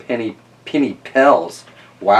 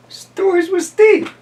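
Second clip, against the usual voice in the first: show intro; 6 dB louder, slower than usual. Acted. Show title announced.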